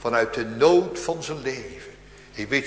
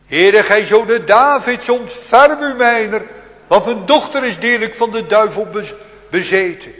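urgent plea; 8 semitones higher, 13 dB louder than usual. Acted. Canaanite woman begging the Lord, loud urgent plea. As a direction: beg earnestly for your daughter. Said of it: "Eerst zei ze nog".

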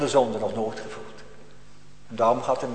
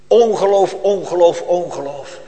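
loud first cry dropping to plain; fading outcry; 3 semitones higher, 6 dB louder than usual. Acted. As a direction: start with a loud call and let each repeat fall. Preacher repeats the word, fading. (luid beginnen, dan wegzakken)

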